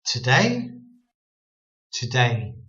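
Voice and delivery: "today" with weak "tuh" and strong DAY; stress on second syllable. Shown as a demonstration. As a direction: punch DAY in "today", dull the O. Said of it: "emphasis on the second syllable".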